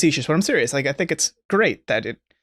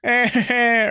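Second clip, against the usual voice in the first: Voldemort impression; 7 semitones higher, slower than usual. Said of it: "where Voldemort goes".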